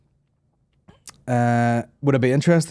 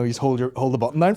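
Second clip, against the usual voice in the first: ordinary talk, nothing marked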